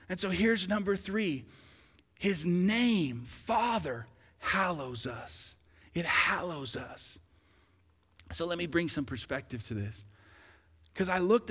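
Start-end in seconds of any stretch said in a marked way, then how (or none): none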